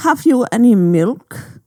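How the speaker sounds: drawn-out cartoon German villain line; mock German accent. Riffing on movie villains, a mock German accent. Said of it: "but what about".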